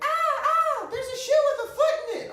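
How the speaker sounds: very high, fast, shrieking girl, hammed up; mock panicked yelling. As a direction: squeal it like a startled passenger. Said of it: "the same girl starts yelling".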